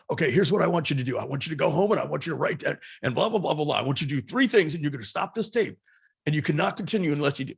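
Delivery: quick, commanding self-help speaker barking orders; Tony Robbins impression. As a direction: pump up the listener like a seminar coach. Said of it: "where he says".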